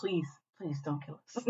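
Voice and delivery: very soft little plea; mock pleading. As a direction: beg the gods under your breath, jokingly.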